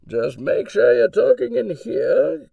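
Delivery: ordinary talk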